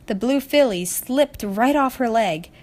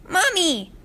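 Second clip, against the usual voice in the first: put-on high, excited child's cry of Mommy; 10 semitones higher than usual. A narrator's reading. Filly spotting a cupcake stand, excited high call. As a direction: call out eagerly, pointing at cupcakes. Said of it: "the filly cried out".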